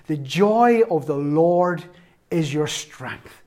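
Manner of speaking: drawn-out scripture line; slow and deliberate. Real, not acted. Preacher reciting scripture to congregation, slowly. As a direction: savour every word slowly.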